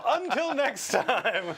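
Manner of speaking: slow, drawn-out sign-off; show sign-off. Acted. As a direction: stretch the goodbye out slowly. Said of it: "sign-off".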